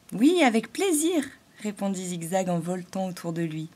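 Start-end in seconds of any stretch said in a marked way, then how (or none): 0.1-1.2 high, eager reply; eager yes. A narrator's reading. Zigzag eagerly agrees to help the halo, high and glad. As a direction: answer brightly, happy to help.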